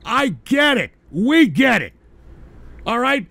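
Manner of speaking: insist, fed up, that you understand; exasperated. Real, not acted.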